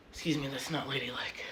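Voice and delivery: soft embarrassed whisper. Real, not acted. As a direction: hush it out as an aside. Apologizing for a burp.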